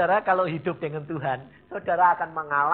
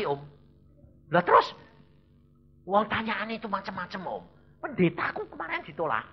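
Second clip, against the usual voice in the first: raised-pitch acted complaint; agitated visa applicant; 6 semitones higher, 4 dB louder than usual. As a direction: pour out the visa troubles. Plays anxious fellow visa applicant. (mengeluh heboh dengan nada naik)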